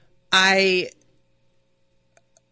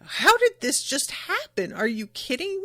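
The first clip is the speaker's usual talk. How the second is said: stunned disbelief. Retold or imitated. Bon Clay watching the transformation, stunned disbelief. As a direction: rise into shocked disbelief. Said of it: "Bon Clay, of course, is just like".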